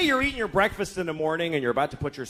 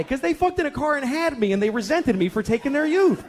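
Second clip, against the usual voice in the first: high, heated grievance; bitter outburst; 9 semitones higher than usual. Acted. Father blaming his parents to his daughter, bitter rant. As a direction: rant as if pushed past your limit.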